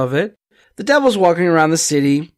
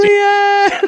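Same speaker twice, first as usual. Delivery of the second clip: shouting; 3 dB louder than usual. Real, not acted. Mock correcting name.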